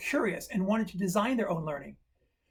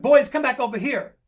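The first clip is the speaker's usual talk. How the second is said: put-on teacher calling kids back, raised pitch; yelling; 5 semitones higher, 8 dB louder than usual. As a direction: call out loudly across the playground. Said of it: "I began to yell at the kids".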